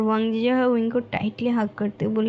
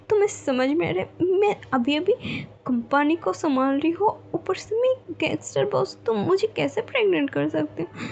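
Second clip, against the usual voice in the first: higher, flustered disbelief, stammering; shocked outburst; 7 semitones higher than usual. A narrator's reading. Wing shocked at being pregnant. (ऊँची आवाज़ में हकलाते हुए हैरानी)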